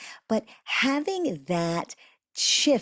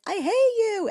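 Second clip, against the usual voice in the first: high, loud I hate you; angry teenager; 4 dB louder than usual. Retold or imitated. Imitating a teenager yelling at a parent. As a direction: throw it at the parent like a furious teen. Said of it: "says ... and they're angry at you".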